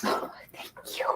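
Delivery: very soft, breathy whispered reaction; hushed, voiceless little thanks. Retold or imitated. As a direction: whisper it as a retold, touched thank-you. Flattered reaction to praise, retold.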